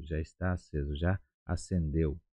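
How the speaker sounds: usual speaking voice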